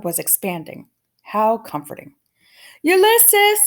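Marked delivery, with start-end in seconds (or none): 2.8-3.6 high, loud call into the dark; shouted name. A narrator's reading. Tootie calling for Ulysses in the dark, shouting. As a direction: call out for the lost squirrel. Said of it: "shouted Tootie".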